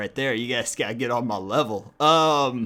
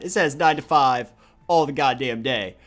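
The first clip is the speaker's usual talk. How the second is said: ordinary talk, nothing marked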